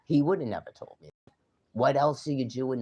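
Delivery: indignant outburst about the secret; angry outburst. Real, not acted. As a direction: spit it out fast and loud. Angry outburst after whisper.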